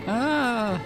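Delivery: high-pitched, drawn-out "ah"; high cry. Acted. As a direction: cry out in one high note. Game victim cries out over music.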